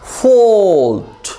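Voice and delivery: ordinary talk, nothing marked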